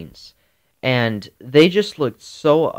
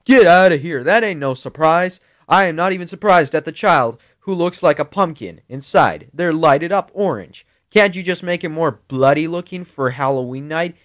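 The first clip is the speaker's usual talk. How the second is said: a loud, higher mock-angry dad; hint of a dad scolding, raised voice; daddy yelling; 5 semitones higher, 4 dB louder than usual. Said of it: "My daddy was like".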